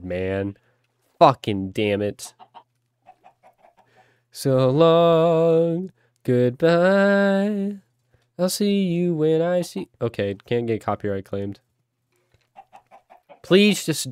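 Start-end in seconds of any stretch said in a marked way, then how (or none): 4.3-9.9 mock farewell. Farewell as chicken falls.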